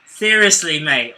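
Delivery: ordinary talk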